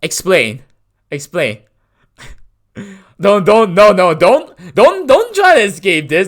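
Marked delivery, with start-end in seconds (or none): 3.2-6.3 mock mad. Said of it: "I'm pretending to be mad".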